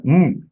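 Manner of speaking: "Mm" as a bare closed-lip hum, no vowel; vowelless consonant. Shown as a demonstration. Teacher showing the halant, "Mm" left without a vowel. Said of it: "It is not having halan".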